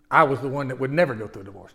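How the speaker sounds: ordinary talk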